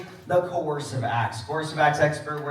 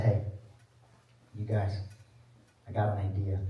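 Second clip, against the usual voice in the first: low, quiet pitch of a plan; conspiratorial; 11 semitones lower, 7 dB quieter than usual. Acted. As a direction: float the idea quietly, in a low voice. Quotes leader proposing secret plan.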